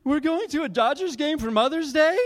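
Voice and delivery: high, loud, incredulous wife's question; hurt disbelief, voice shooting up; tearful disbelief. Retold or imitated. Wife asking if this is her Mother's Day gift, loud and high. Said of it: "and she's like".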